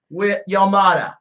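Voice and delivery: loud, high mock-Australian proclamation; Australian accent. Spirit completing the doom prophecy, Australian accent. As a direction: finish it off in hammed-up Australian. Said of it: "I'll do the accent".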